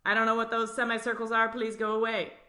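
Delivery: fast, high and worried; timid blood cell. Acted. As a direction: rush it out, begging them to leave. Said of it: "Give it type B blood".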